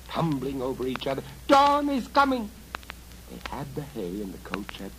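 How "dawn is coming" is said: high, loud goblin cry; panicked goblin warning. Acted. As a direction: shriek the warning.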